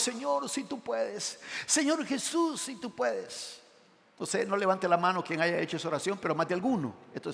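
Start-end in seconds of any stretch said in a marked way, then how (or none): 0.0-3.6 doubtful pleading prayer. Retold or imitated. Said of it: "muchas veces uno ha orado a Dios".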